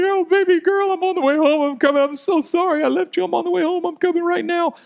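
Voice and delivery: high-pitched, hammed-up tearful pleading; imitated crying father. Dad apologizes to crying daughter from abroad, mock sobbing. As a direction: mimic a dad blubbering into the phone. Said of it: "I'm like, I'm crying".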